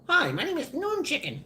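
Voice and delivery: a high, cartoonish hello; chirpy high put-on voice; silly high intro.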